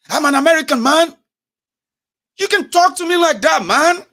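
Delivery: high, loud, badly faked American accent; fake American accent. Traveller arguing at airport counters, fake American accent. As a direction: bluster in an exaggerated American accent. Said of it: "it's not gonna sound like an American".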